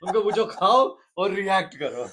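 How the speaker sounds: high, very loud, laughing hard. Real, not acted. Guest relishing his own empty-plate joke, laughing loudly. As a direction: burst out with it through laughter.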